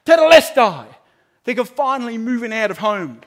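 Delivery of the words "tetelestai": high, loud victory cry; loud jubilant cry; triumphant shout. Pretend. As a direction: celebrate finishing exams.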